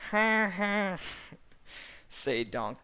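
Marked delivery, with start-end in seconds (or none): 0.1-1.0 high loud put-on cackle; a hammed-up braying laugh; Eddie Murphy laugh. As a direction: laugh big like the cartoon donkey. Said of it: "Eddie Murphy laugh".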